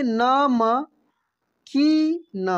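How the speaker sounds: नमकीन stretched very slowly, each syllable separate. Shown as a demonstration. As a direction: sound out नमकीन slowly for the class. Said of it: "क्या पढ़ेंगे हम लोग?". (नमकीन को धीरे धीरे खींचकर बोलिए)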